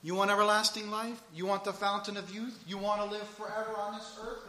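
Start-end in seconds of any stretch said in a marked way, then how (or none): none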